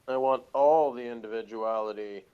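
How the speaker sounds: ordinary talk, nothing marked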